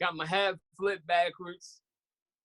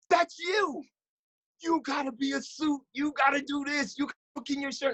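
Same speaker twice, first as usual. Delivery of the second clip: higher, mock-irate Italian coach; 10 semitones higher than usual. Coach scolding his player, higher and mock-irate. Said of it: "He's irate Italian".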